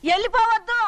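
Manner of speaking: urgent call. Acted. Mother telling her boy not to leave, urgent call. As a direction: call out to stop someone leaving.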